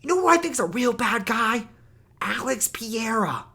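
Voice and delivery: high, loud, mocking Chael voice; mock Chael Sonnen. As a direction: play him as a clueless hype man. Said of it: "He's like".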